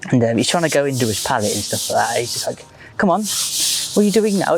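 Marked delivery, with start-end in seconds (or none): 3.0-4.5 high-pitched mock Welsh accent; put-on Welsh lilt, higher than usual; [name]'s Welsh accent. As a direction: do an exasperated Welsh angler, pitched up. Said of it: "that was my best Welsh accent".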